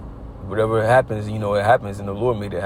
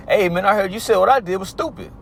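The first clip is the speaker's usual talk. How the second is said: Josh confronting; 9 semitones higher than usual. Retold or imitated.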